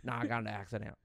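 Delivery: low Cajun mumble; Cajun impression. One-legged Cajun guide explains his lost leg, Cajun accent. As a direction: answer in a slurred Cajun accent. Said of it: "They really do talk like that".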